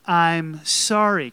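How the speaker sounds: slow, loud, drawn-out model apology; leading group repetition. Shown as a demonstration. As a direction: say it big and slow for a crowd to join. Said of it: "Let's say it one more time".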